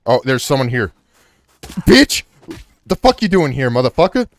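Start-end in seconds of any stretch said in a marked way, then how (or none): 1.6-4.3 loud, high playful confrontation; mock-aggressive shout. Player confronts an intruder in the game, mock-aggressive shout. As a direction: yell at the intruder in mock outrage.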